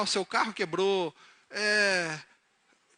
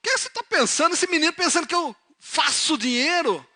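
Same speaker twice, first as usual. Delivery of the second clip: loud, high, indignant questioning; worked-up anger; 10 semitones higher, 7 dB louder than usual. Acted. Father angry at son's telegram asking for money, worked up. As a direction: rant like an outraged father. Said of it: "E ficou nervoso".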